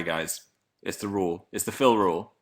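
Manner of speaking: usual speaking voice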